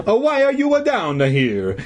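put-on high-pitched, demanding Italian accent; indignant old Italian janitor; hoarse Italian voice. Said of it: "in his hoarse Italian voice".

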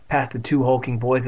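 usual speaking voice

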